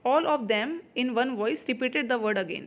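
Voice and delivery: slow, word by word sentence reading; slow dictation read. Shown as a demonstration. Teacher reading the exam question to students, slowly. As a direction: spell the sentence out for the class.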